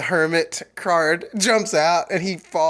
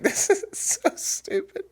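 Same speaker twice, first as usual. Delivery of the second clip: soft, breath gone with laughing; helpless laughter; 5 dB quieter than usual. Retold or imitated. Mocking a bad horror movie to a friend, helpless laughter. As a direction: squeeze the words out through a laughing fit.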